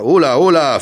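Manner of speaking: loud, high call to stop; indignant halt. A narrator's reading. Governor stopping the man who wants to leave, indignant. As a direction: cut in to halt someone.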